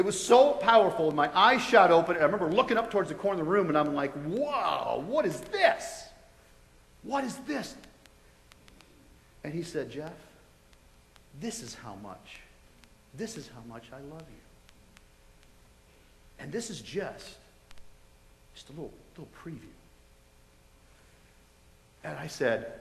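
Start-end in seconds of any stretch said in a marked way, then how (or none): 4.2-7.8 high, stretched-out amazement; retold astonishment, rising high then softening; awestruck. Patient looks up as love floods over him, awestruck. 9.9-19.7 low, hushed, tender divine voice; soft but clear. Retold or imitated. The voice shows him how much he is loved, soft and clear. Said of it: "real soft but real clear".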